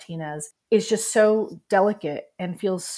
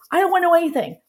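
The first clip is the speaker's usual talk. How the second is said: imitated husband's outburst; 11 semitones higher, 7 dB louder, much faster than usual. Husband refusing on the phone.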